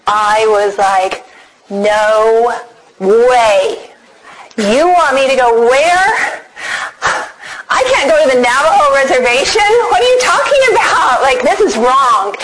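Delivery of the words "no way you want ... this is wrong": retold shock rising high into protest; incredulous disbelief. She replays reading her mission call to the reservation, incredulous.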